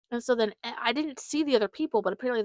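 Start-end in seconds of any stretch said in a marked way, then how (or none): none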